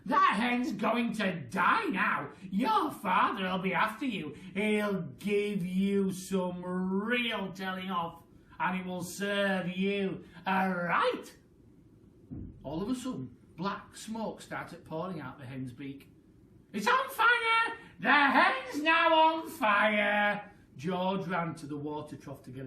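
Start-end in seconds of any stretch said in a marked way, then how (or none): 0.0-11.4 loud, slow scolding rant; angry Grandma. Acted. 16.7-20.5 very high, loud, slow cry; alarmed shout. Acted. Grandma panicking at fire.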